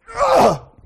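loud, high exasperated ugh; frustrated grunt. Retold or imitated. Pastor stuck in traffic, loud high frustrated grunt. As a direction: let out a burst of traffic rage. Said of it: "I just went".

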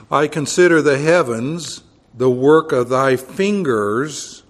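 preacher reading scripture aloud from the pulpit. Acted. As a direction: proclaim the verse to the congregation.

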